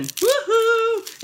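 high, loud whoop; excited cheer. Real, not acted. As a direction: let out a thrilled woohoo. Cheer before tasting.

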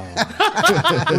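sudden high burst of laughter. Real, not acted. Laugh at cook story. (mataas na tawa)

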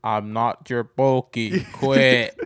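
slow, drawn-out mock protest; imitated annoyed streamer. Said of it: "and then the guy's like".

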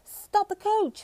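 high-pitched royal command; ordered. A narrator's reading. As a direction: call out an order, pitched well up. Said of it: "he ordered".

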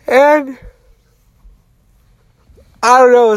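high, loud cry of disbelief; a real burst of surprise. Real, not acted. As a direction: sound baffled as the scale goes up. Shocked at gaining weight after shower.

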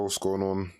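usual speaking voice

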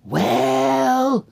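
high, stretched-out exclamation; indignant Mama Bear. Acted. Mama Bear sniffs her porridge, high indignant cry. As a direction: draw it up high as an affronted bear. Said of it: "And then Mama Bear goes".

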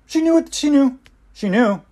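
insisting she knew, pitched high; emphatic insistence. Real, not acted. Emphatic defense.

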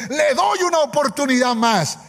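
high-pitched, angry ultimatum; annoyed king. Retold or imitated. King threatening the young men who refused, very annoyed. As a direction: demand an answer as an irritated king.

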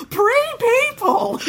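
very high, slow, laughing shriek of "Pre-people!"; mock delighted shriek. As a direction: stretch "Pre-people!" into a gleeful squeal. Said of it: "He goes".

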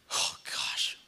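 breathless whispered oh gosh; teary whisper. Real, not acted. Pastor fighting tears before his church, whispered. Pastor crying mid-sermon.

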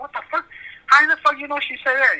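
high shrill disbelief; shrill screeching bird woman. Retold or imitated. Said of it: "I was like".

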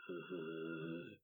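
low, very soft drawn-out "uh"; imitated eerie groan. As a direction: let out a quiet low groan. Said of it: "starts going".

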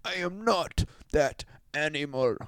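high, fast mocking denial; a hint of a haughty foreigner protesting; mock Turk or Greek. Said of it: "every Greek person was like".

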